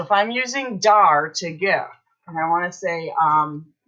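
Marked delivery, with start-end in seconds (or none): none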